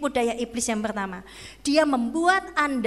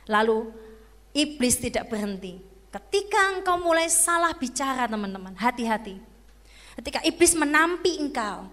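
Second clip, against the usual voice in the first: ordinary talk, nothing marked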